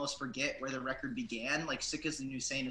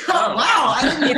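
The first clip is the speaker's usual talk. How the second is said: laughing surprise; 13 semitones higher, 14 dB louder, slower than usual. Real, not acted. Surprised the album was heard.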